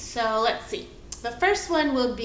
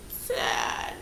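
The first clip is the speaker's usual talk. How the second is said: whispered sad; 6 dB quieter than usual. Shown as a demonstration. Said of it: "each one on one count".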